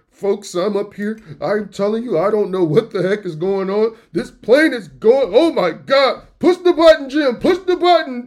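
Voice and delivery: hammed-up captain losing control, building to a shriek; panicking pilot. Pilot on the intercom panicking at Jim, building to loud high yells.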